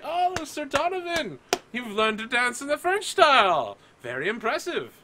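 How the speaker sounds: very high, swooning society praise; exaggerated high-pitched crowd admiration; fawning admirers. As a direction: coo in a high voice over his talents.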